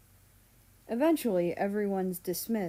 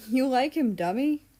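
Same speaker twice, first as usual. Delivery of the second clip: teasing; 6 semitones higher, 6 dB louder than usual. Acted. Mal teasing again.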